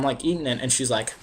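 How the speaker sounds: ordinary talk, nothing marked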